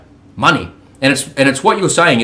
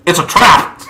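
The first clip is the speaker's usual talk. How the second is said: higher, mock alarmed "it's a trap"; Star Wars quote; 6 semitones higher, 4 dB louder, slower than usual. Sailor quoting Star Wars to his podcast host, mock alarm. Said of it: "in Star Wars where he goes".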